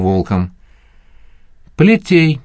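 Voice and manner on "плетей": sharp, high, loud order; barked command. A narrator's reading. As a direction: snap out the sentence. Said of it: "скомандовал Троян".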